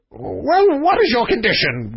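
high-pitched grudging growl; growling king. Acted. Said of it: "growled the king".

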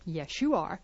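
quiet. Shown as a demonstration. Quiet take of repeated line.

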